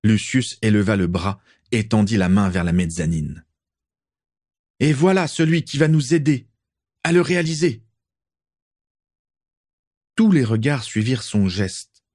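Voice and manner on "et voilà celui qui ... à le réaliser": grand announcement. Acted. Lucius presents Voldemort.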